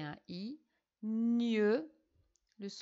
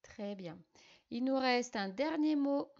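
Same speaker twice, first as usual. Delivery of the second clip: ordinary talk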